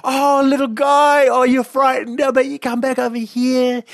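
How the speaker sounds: put-on high, slow cooing baby voice; a high sweet coax for the scared dog; coaxing baby talk. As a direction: coo him back over. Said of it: "And then you go".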